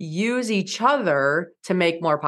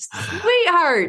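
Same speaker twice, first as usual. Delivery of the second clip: sweet mock patience; 10 semitones higher, 3 dB louder than usual. Mom gently corrects her son.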